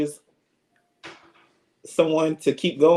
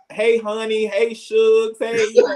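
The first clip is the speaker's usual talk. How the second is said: Alabama greeting; 7 semitones higher than usual. Shown as a demonstration. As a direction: call out sweetly in an Alabama twang. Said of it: "In, in Alabama, you know, we".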